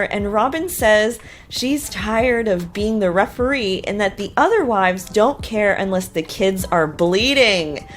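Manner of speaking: usual speaking voice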